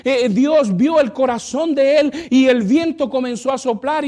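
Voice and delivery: loud, high preached storytelling; preaching at full force. Acted. Pastor retelling a testimony to the church, loud.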